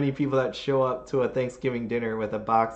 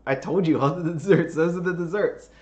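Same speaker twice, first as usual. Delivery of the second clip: high, laughing, half-exasperated; retold disbelief, laughing; laughing exasperation; 6 semitones higher, 3 dB louder than usual. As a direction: laugh through the protest that they are the desserts. Exasperated retelling to sister-in-law.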